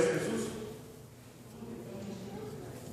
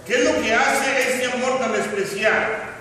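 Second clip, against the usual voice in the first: raised, emphatic sermon voice; fervent preaching; 9 semitones higher, 8 dB louder than usual. Acted. Preacher proclaiming God's love to the congregation, fervent preaching.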